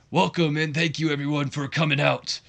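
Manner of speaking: mild put-on accent, ring announcer style, welcoming the crowd; Johnny Laurinaitis accent. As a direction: welcome the crowd like a corporate wrestling boss, half-imitated. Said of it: "he's got a Johnny Laurinaitis, uh, accent".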